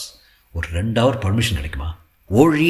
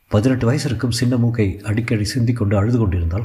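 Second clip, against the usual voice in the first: plain reading voice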